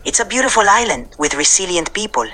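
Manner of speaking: measured Puerto Rican-accented English with Spanish mixed in. Shown as a demonstration. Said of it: "I'll keep the Puerto Rican accent going".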